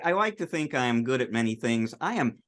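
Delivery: normal talking voice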